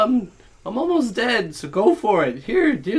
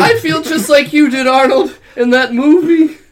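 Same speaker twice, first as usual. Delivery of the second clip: loud taunt; 11 semitones higher, 13 dB louder than usual. Acted. Character taunts loudly.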